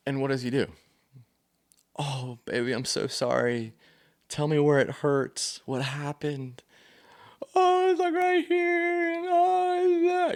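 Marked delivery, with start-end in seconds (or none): 2.0-6.6 soft, slow comforting dad voice; tender dad. Retold or imitated. Dad soothing his hurt daughter, tender and soft. 7.5-10.3 put-on very high small child's voice; little girl. Daughter showing dad where it hurts, high child voice.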